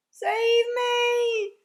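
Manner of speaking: high, very loud scream for help. A narrator's reading. Giant screaming in his nightmare, drawn-out terrified shriek. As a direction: scream it, stretching both words. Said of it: "screamed the flesh lump eater".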